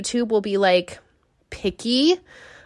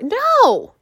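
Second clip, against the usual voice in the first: a much higher, louder no; emphatic no; 14 semitones higher, 4 dB louder than usual. Real, not acted. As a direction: shoot down the idea firmly. Emphatic answer to own question.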